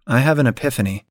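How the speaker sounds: usual speaking voice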